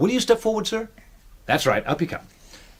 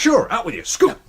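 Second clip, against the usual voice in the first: dismissive colonel; 9 semitones higher, 4 dB louder than usual. Acted. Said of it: "imagine I'm Colonel Grace".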